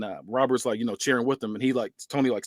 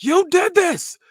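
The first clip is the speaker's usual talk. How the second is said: much higher, louder accusing yell; acted yell; 4 dB louder, much slower than usual. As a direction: throw the blame at him. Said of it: "and he yells".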